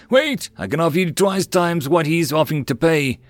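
high, urgent merchant voice; a desperate seller pushing in; panicked merchant calling after them; eager plea. Acted. Merchant rushes out pleading.

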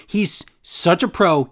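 ordinary talk

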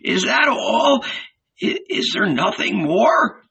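high, tearful, faltering plea; trembling voice. A narrator's reading. Old Ananias asks if the parable is over, trembling. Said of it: "his voice trembling".

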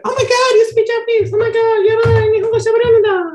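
very high squealed mock amazement; imitated excited girl. Imitating girls amazed he speaks Japanese, squealing excitedly. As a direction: gush in a loud falsetto.

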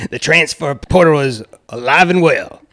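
put-on Sopranos-style voice; Dallas Woodhouse. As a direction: do him like a regular at the Bada Bing. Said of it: "You should do it in Dallas's voice".

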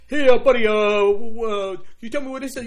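a Jersey guy asking for directions, loud and high; man from New Jersey. Retold or imitated. Stranger from New Jersey asks directions, mock Jersey voice. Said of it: "somebody come up and say".

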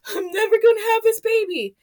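high, tearful wail of defeat; despairing cry. Retold or imitated. Laboring mother told she's only at six, tearful high wail. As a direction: cry it out as if you'll never get there.

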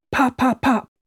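high, loud percussive echo; three punched-out pops; imitated engine pops. A narrator's reading. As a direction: echo the test fire in sharp bursts. Trainee echoes the engine pops.

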